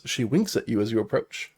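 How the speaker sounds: ordinary talk, nothing marked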